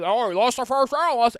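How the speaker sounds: high, loud imitation of everybody overreacting; mock panic outcry. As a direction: wail it like a panicking crowd. Mimics everybody panicking over lost round.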